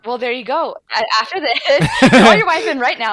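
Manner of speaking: loud, laughing teasing order. Real, not acted. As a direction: laugh it out as a joke. Joking order through laughter.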